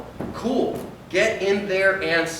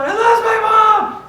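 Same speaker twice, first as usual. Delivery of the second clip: put-on much higher, loud slow kid voice; scared little boy; 8 dB louder, much slower than usual. Lost boy Jimmy answers the stranger, high, loud and slow. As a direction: answer like a frightened child.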